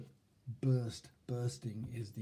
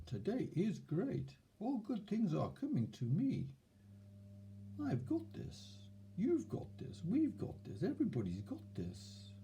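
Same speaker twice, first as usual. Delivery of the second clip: much higher, sing-song hammed-up positivity; mock affirmations; 7 semitones higher than usual. Shown as a demonstration. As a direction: parody forced cheerful affirmations. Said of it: "So instead of".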